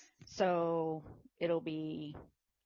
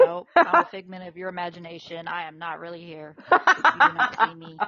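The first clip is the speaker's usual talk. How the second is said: mock ghostly denial; 14 dB louder than usual. Pretending to be invisible.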